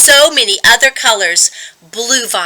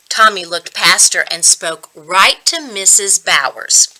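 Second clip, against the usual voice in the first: ordinary delivery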